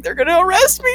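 high-pitched mock panic; retold panic. Scared kid realizing police came for them, retold panic. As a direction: pitch climbing as if doomed. Retold fear of being arrested.

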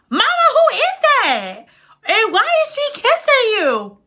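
put-on high, stunned little-girl voice; shocked daughter. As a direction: squeak it out in disbelief. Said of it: "Marion is flabbergasted".

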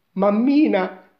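put-on high, loud boy's cry; frightened exclamation. A narrator's reading. Scared Giacomino calls his mother, high exclamation. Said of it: "esclamò".

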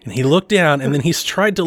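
normal talking voice

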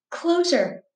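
ordinary delivery

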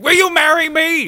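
very high, loud, hammed-up plea; mock shouted proposal. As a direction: yell it like an overwrought movie suitor. Acting out the grabbing proposal.

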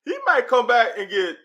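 high, laughing, worked up; excited laughing. Real, not acted. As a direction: tell it through laughter. Excited about Juton, laughing.

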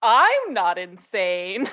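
"I'M not insane", with "I'm" long and punched; emphasis on I'M. Retold or imitated. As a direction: drag out and stress "I'm" in a slow "I'm not insane". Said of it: "Emphasis on the different words".

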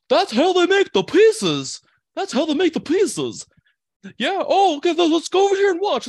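high, loud mocking voice of a greedy woman; mock hefty lady. Mocking a stranger in line to friends, high squeaky mock voice. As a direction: ham up a pizza-hungry lady, squealing high.

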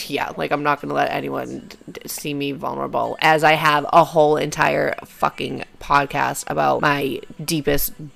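ordinary talk, nothing marked

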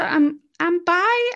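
high, slow, hesitant confession; nervous confession. Retold or imitated. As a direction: squeak it out slowly. Coming out to mum.

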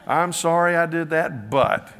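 mock defensive apology. Mocking people's ruined apologies.

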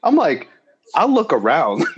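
ordinary talk

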